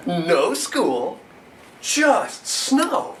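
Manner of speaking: put-on bouncy poem for children, clipped beats; lively poem reading. Poet performs a snowy sledding poem for kids, lively reading. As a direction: read it with playful punch.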